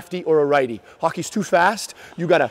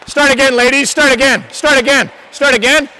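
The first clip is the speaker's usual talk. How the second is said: calling out; 5 semitones higher, 6 dB louder than usual. Real, not acted. Coach calling out to skaters.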